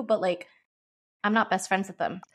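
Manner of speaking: ordinary talk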